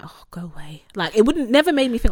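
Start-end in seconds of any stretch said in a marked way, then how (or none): 0.0-0.8 whispered brush-off. Retold or imitated. Brushing off father's complaint.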